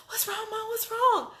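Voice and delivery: high, urgent imitation of her daughter; worried daughter. Daughter hearing screaming, asks what's wrong, high and urgent. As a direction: voice the scared kid checking on mom. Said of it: "She was just like".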